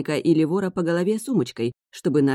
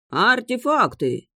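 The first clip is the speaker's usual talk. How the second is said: market hawker shout; 6 semitones higher, much slower than usual. A narrator's reading. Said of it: "заорал над ухом громила".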